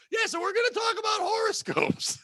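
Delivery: high laughing announcement; laughing shout. Real, not acted. Laughing reset to the topic.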